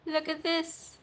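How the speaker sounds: ordinary talk, nothing marked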